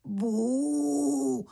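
long high moo; lamb's moo. A narrator's reading. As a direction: moo like a lamb imitating a calf. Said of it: "řekl beránek". (dlouhé vysoké zabučení)

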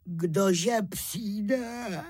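sleepy crayfish waking up; yawning. A narrator's reading. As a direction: ask through a yawn, barely awake. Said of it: "Zívl vavřinec, sotva se trochu probral".

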